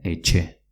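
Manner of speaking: "etxe", sounding like eche. Shown as a demonstration. Teacher gives the Basque word for house, tx as ch. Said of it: "con una T X".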